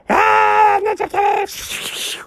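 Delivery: high, slow drawn-out shout of "Ninja Kitty"; shouted alarm. Real, not acted. Shouting at the cat that just showed up.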